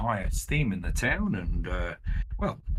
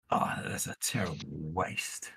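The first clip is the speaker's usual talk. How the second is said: hushed, lower mock sympathy; flirty whisper; 5 semitones lower than usual. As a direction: whisper the compliment to her.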